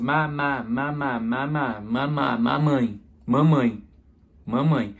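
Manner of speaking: lightly voiced toddler sounding out mama; baby's first words. Shown as a demonstration. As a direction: play a small child trying its first word. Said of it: "Eu só vou aprender a falar quando eu começo".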